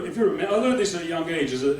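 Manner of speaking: ordinary delivery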